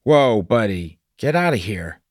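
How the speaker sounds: startled shout. Acted. Doug yells at the seagull stabbing his windshield, alarmed and loud. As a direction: shoo the bird off in a sudden fright.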